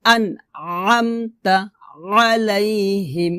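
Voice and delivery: slow "আনআমতা আলাইহিম" with squeezed throat; pressed ayn. Shown as a demonstration. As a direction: pharyngeal onset on "আলাইহিম". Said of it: "আমার সাথে বলেন".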